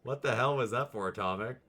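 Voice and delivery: loud, startled call to the cat; startled outcry. Real, not acted. Startled by the cat's noise, calling out loudly. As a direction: yelp it out in surprise.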